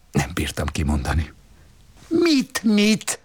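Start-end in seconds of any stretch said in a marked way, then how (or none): 2.1-3.2 high sharp snapped question; snapping. Acted. The wife snapping at her husband, in a sharp high snap. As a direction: snap it back, shrill and quick. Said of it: "Csattant föl az asszony".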